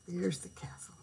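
breathy whispered aside. Real, not acted. Whispered aside while reading.

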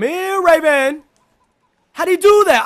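loud, high shouts in the chase; excited yelling. Real, not acted. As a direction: yell high in the heat of the game. Yells while chasing in game.

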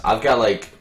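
normal talking voice

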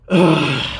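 loud, exasperated groan; big imitated "ugh"; annoyed groan. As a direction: groan like a fed-up customer. Customer's groan, acted out.

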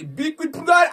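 high, loud exasperated yell; angry shout. Real, not acted. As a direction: yell in disgust at the game. No big win. (teriakan jengkel)

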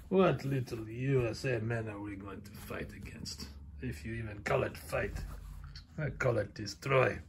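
low, soft, sneering heel voice; menacing Russian wrestler. Acted. Dad voicing the Russian toy wrestler, low and menacing. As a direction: growl it out like a brute promising destruction.

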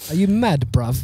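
loud high roadman slang, joking; London roadman accent. Pretend.

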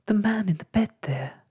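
high, small scared voice; frightened whisper. A narrator's reading. Ragged Blossom points out the Banksia man, frightened whisper. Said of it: "whispered Ragged Blossom".

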